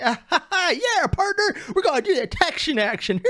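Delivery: a clumsy, hammed-up Texan accent, pitched high; bad Texan accent. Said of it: "I cannot do a Texan accent".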